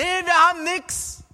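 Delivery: high, loud, slow call back; shouted reply. Acted. Another fisherman shouts back to the stranger, loud call. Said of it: "der hat dann geschrien".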